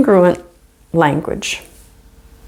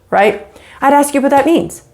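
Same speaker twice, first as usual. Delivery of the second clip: ordinary talk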